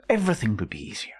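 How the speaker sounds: fast, unvoiced whisper; whispered aside. Shown as a demonstration. A whispered wish about the world, fast and unvoiced. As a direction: breathe it out as a quick secret.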